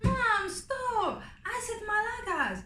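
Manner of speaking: put-on a kid begging mom to quit teasing; exasperated daughter.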